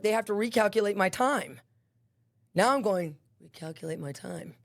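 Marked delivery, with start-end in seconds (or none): 3.4-4.6 much softer, lower voice; musing to oneself. Retold or imitated. Inmate thinking about her sentence, musing to oneself. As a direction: think aloud under your voice.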